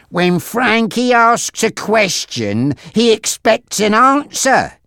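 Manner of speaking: high, drawn-out warning; slow, pointed threat from a gang henchman; menacing henchman Monty. Acted.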